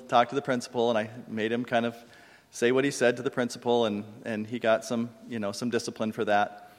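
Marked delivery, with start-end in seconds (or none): none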